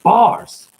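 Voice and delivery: high, loud one-word exclamation; triumphant shout. Real, not acted. Freestyler hailing their own verse, loud high shout. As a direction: shout it to celebrate your own rhyme.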